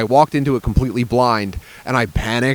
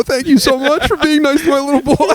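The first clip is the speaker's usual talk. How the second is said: mock grateful dad; 14 semitones higher than usual. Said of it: "I said".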